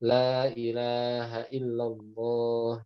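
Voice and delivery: La ilaha illallah drawn out slowly and evenly; slow talqin recitation. Shown as a demonstration. Teacher demonstrates talqin for a dying person, slowly. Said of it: "talqin itu seharusnya mudah".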